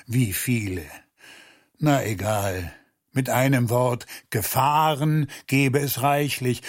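usual speaking voice

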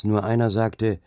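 usual speaking voice

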